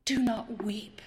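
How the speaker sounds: soft comforting hush; tender whisper. Acted. Jesus to the grieving widow, tender whisper.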